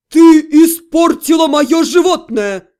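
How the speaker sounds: loud, slow outraged roar; demon's yell. A narrator's reading. Demon accusing mage of ruining his animal, yelling. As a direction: yell the accusation, stretching each word. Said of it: "заорал демон".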